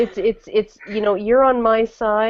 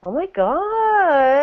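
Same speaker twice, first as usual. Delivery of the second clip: put-on high, drawn-out Valley Girl uptalk; uptalk accent; 7 semitones higher, much slower than usual. Shown as a demonstration. Showing friends the accent, exaggerated uptalk. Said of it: "I could do the uptalk accent".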